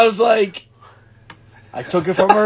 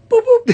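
high beep sounds; 11 dB louder than usual. Retold or imitated. Imitating modem sound.

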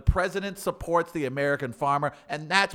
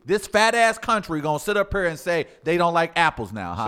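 louder, higher indignant defense; mock indignation; 3 semitones higher, 5 dB louder than usual. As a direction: rant like an outraged spokesman. Indignant spokesman act defending the client.

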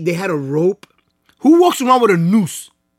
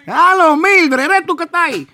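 high, loud Dominican caller; mock phone caller; 10 semitones higher, 4 dB louder than usual. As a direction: holler down the phone in Spanish, hammed-up.

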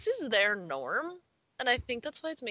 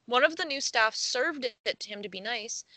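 usual speaking voice

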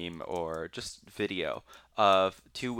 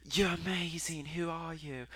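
high-pitched British gushing; British accent; 8 semitones higher, 4 dB quieter than usual. Retold or imitated. British porn stars meeting the trophy winner, British accent. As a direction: coo it in a put-on British accent. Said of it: "that's my British accent".

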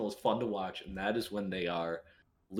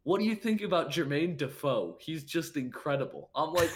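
incredulous retelling; 8 semitones higher, 3 dB louder than usual. Retold or imitated. Retold shocked reaction to texts.

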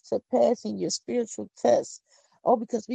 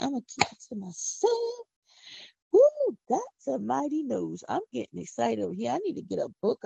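ordinary talk, nothing marked

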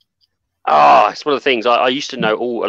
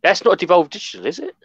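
surprised; 9 semitones higher, faster than usual. Real, not acted. Surprised at wrong answer.

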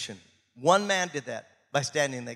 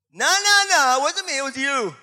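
high, loud squeaky denial; playful Moses voice; 11 semitones higher, 9 dB louder than usual. Retold or imitated. Moses arguing with God, high and squeaky. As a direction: pass the blame in a comic squeal. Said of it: "Moses goes".

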